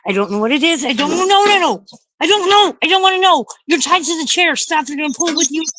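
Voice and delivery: real panic, high and loud; freaking out. Real, not acted. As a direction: plead in rising, frightened bursts. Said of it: "I'm freaking out now".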